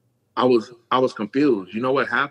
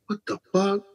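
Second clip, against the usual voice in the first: stunned reaction; 10 semitones higher than usual. Retold or imitated.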